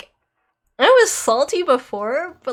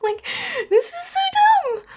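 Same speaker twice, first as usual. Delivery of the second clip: choked exasperation; 14 semitones higher than usual. Real, not acted. Real frustration rant.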